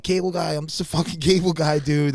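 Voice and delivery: high, pleading panic, half laughing; retold frantic cable guy; panicked plea. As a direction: beg them not to shoot. Panicked plea to raiding cops.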